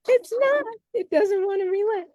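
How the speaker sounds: high-pitched, quick amused protest; half-laughing at a stubborn sheet of paper, pitch jumping way up; playful, high-pitched fluster; amused exasperation. Real, not acted. Paper jams, host laughs it off.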